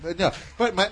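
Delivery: ordinary delivery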